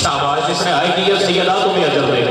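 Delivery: loud, impassioned grieving recitation; full-force majlis wail. Acted. Reciter wailing the mourning verses at full force to the mourners. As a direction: cry out the elegy to the mourners.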